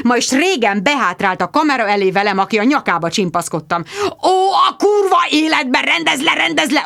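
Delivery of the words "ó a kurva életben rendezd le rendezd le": very high, loud panicked yell; panicked shout. Acted. Producer screams at Bridget as horse bolts, panicked. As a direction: scream to wrap it up. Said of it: "ordította Richard".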